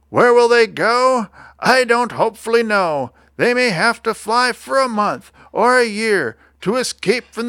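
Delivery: high-pitched creature lament; aggrieved little creature with a smoggy cough; croaky complaint. A narrator's reading. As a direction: plead and protest in a high cartoon croak. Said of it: "he cried with a crufulous croak".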